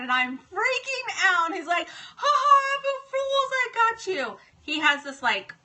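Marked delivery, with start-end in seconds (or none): 1.9-4.4 high, loud mock taunt; gleeful gotcha. Son reveals his prank to mom, imitated gleeful crowing. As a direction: imitate a kid crowing over a successful prank.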